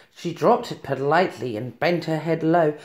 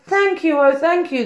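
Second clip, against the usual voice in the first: high heartfelt thanks; grateful cry; 8 semitones higher than usual. A narrator's reading. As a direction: pour out your gratitude. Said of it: "the grateful witch cried".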